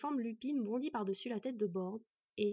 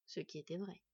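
ordinary delivery, nothing marked

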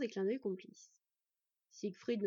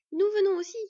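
much higher, loud happy announcement; joyful declaration; 9 semitones higher, 13 dB louder than usual. A narrator's reading. Sylveig announcing the sisters are coming, joyful and loud. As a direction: chirp it out gleefully. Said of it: "décréta joyeusement Sylveig".

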